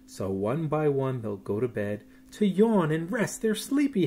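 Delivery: put-on lilting storybook voice for children; sing-song bedtime reading; reading a bedtime story. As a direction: read it like tucking kids in. Reads bedtime picture book aloud.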